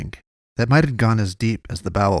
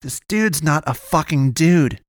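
higher-pitched, indignant retort; countering; 6 semitones higher than usual. A narrator's reading. Friend arguing against helping the werewolf, countering. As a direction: counter it hard in a raised pitch. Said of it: "Gunnar countered".

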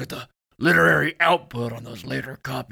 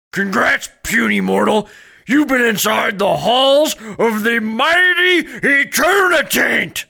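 dragon voice; 6 semitones higher, 4 dB louder than usual. Acted. God addresses a puny mortal.

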